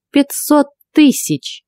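put-on high, slow old woman's pronouncement; name the price slowly and emphatically; loud announcement. A narrator's reading. Old witch naming the price to captive, emphatic. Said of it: "громко произнесла она".

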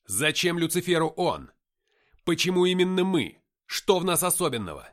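loud, insistent argument; heated questioning. A narrator's reading. Narrator questioning why Lucifer needs him, heated insistence. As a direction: press him hard for an answer.

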